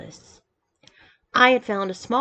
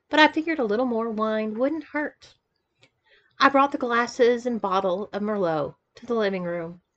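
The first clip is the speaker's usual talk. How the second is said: plain reading voice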